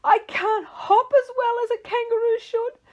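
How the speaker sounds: high, loud crying howl; crying wail. Acted. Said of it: "howled even louder".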